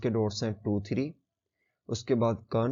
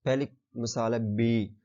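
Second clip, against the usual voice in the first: ordinary talk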